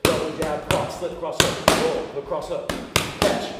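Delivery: loud, punchy combo calls; calling combos. Shown as a demonstration. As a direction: bark each strike as she hits. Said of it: "any cues that I have, you guys are gonna hear".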